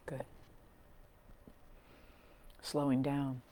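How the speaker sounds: soft, calm meditation voice with long pauses; guided meditation. Real, not acted. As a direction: gently guide them into breath and body, leaving space between phrases. Leads a grounding meditation.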